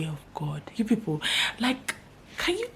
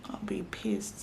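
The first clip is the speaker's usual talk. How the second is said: breathy, voiceless admission; whispered aside; 10 dB quieter than usual. Real, not acted. Admitting to viewers she is pissed, in a whisper. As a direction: drop to a whisper to confess it. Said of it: "I'm pissed".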